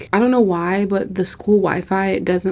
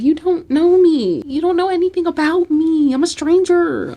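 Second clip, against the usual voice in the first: mock protest; 8 semitones higher, 4 dB louder than usual. Retort to people cheering her on, mock protest. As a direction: push back in a high, exaggerated voice.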